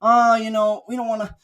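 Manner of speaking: high, loud sarcastic mock whine; mocking whiny team. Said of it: "teams to just be like".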